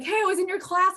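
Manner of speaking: high, bright mock student greeting; eager greeting. Student meeting teacher in person: high excited hello.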